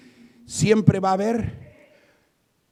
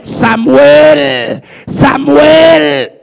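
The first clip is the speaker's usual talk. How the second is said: loud, raised, drawn-out call; 5 semitones higher, 16 dB louder, much slower than usual. Acted. God calls the boy in the temple, loud drawn-out calling. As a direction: shout the name as if summoning from afar. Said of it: "volvió a llamarle y le dijo".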